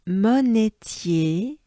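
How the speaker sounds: monetier slow with a rising end; slow drilled monetier; slow French pronunciation. Shown as a demonstration. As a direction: pull out each syllable of monetier. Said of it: "we accentuate the E part".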